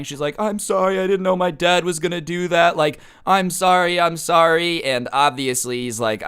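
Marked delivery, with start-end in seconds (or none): 0.4-4.8 higher, pleading mock upset; crying girlfriend. Girl apologizing to her ex for her dad, mock tearful. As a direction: imitate a tearful apology without real sobs. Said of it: "she's crying".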